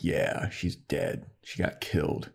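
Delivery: slow, breathy and hushed; muted grim Riley. Retold or imitated. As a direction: let the words fall out quietly, slowly.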